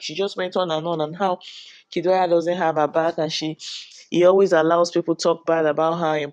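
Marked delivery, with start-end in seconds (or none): none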